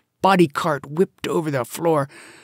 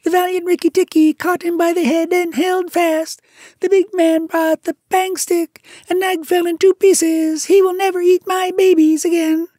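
very high, loud chirpy bird voice; a shrill little bird, bursting with triumph; tailorbird; 8 dB louder than usual. A narrator's reading. Bird tells the mongoose the news, triumphant.

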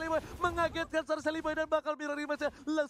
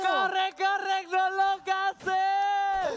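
loud, slow high yell; shout; 6 semitones higher, 6 dB louder, much slower than usual. Real, not acted. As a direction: shout it out slowly. Hype shouting.

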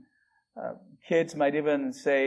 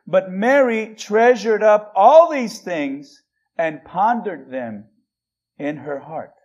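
slow scripture reading; 4 dB louder, slower than usual. Shown as a demonstration. We read this.

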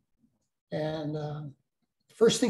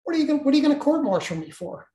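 alarmed protest; 9 semitones higher, 4 dB louder, faster than usual. Retold or imitated. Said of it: "I'm like".

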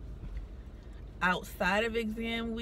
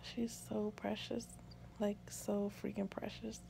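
very soft, hushed and lingering; tender adoration; 13 dB quieter than usual. Real, not acted. New mother calling her baby precious, hushed adoration.

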